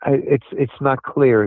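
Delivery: usual speaking voice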